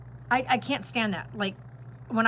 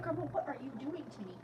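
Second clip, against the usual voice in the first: voicing the baby; 5 semitones higher, 10 dB quieter than usual. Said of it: "He's like".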